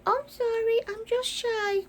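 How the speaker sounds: a light high little dog voice; voicing the dog. Owner speaking for her shy Chihuahua, lightly high.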